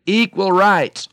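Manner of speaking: loud, high, stretched-out goose protest; hammed-up goose outcry, high and loud; mock honk. A narrator's reading. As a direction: honk it out slowly as a slogan. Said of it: "honked the goose".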